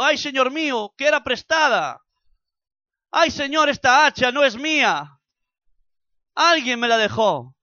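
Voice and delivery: loud, high cry of dismay over the lost axe head; anguished cry. Acted. Woodcutter crying out to God over the borrowed axe, loud and high. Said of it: "Y gritó diciendo".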